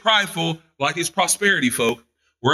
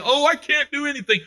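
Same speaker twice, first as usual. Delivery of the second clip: whiny coward; 11 semitones higher than usual. Retold or imitated. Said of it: "Don't go over there and say".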